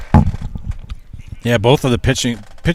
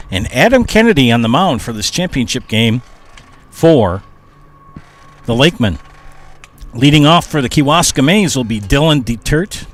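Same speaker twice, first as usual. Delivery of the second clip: broadcast lineup read, raised and steady; announcer voice; 4 semitones higher than usual. Real, not acted.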